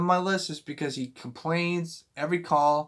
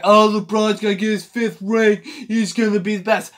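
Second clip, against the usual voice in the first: a lightly put-on smug fan voice, a bit louder and higher; mocking LeBron fans; 4 semitones higher, 5 dB louder than usual. Ranting vlogger mocks LeBron fans who expect a fifth ring, in a lightly put-on smug voice. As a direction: parody the fans' bragging. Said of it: "everyone thinks that".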